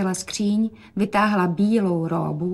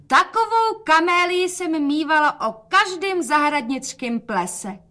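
higher, raised calling voice; 8 semitones higher, slower than usual. A narrator's reading. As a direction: call it across the room. (volání nahlas)

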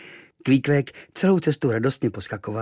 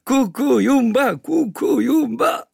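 high, slow sing-song chant of a strange word; foreign islander voice; 8 dB louder, slower than usual. Acted. Kvíkvék greets the boy, high and slow. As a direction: voice Kvíkvék chanting his own language. Kvíkvék speaks his own language.

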